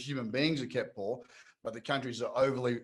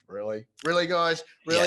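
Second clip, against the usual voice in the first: mock disbelief; 3 semitones higher, 4 dB louder than usual. Speaker reacting to Swedes praising chip implants, mock disbelief.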